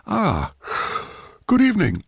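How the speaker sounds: yawning sleepy greeting. Acted. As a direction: stretch and yawn it out. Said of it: "converting my cry into a yawn".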